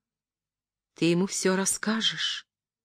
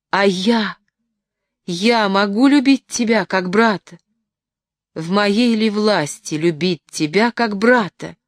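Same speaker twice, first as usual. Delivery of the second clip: louder, anguished outcry; crying out; 3 semitones higher, 6 dB louder than usual. A narrator's reading. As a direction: plead aloud as if the words tear out of her. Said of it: "вскричала она".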